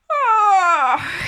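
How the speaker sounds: big loud high yawn. A narrator's reading. Mister Lazy arrives yawning, a big loud yawn. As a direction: stretch it out as a huge yawn. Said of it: "he yawned".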